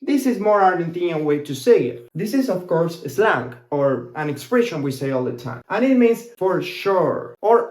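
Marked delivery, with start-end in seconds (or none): none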